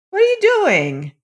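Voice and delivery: mother crying out in alarm, high. A narrator's reading. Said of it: "cried his mother".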